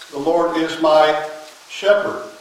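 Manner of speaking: slow, with "my" lifted and stretched; stress on MY. Shown as a demonstration. Preacher showing the flock whose shepherd, slow stress on "my". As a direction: dwell on "my" as personal, slowly. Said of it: "And secondly, I want to look at it".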